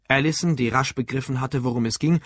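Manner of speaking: ordinary delivery, nothing marked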